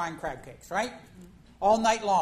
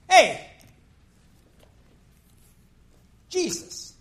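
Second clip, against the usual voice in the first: loud, higher demand to Jesus; angry Martha; 3 semitones higher, 4 dB louder than usual. Acted. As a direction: shout at the boss for help.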